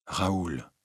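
soft, tender address; great gentleness. A narrator's reading. Christine turns to Raoul, very gentle. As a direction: say the name very gently. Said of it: "dit-elle alors avec une grande douceur".

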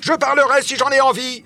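very high, defiant shout; angry outcry. A narrator's reading. Michel grabs Nicole's arm violently, angry cry. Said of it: "s'écrie Michel en saisissant avec violence".

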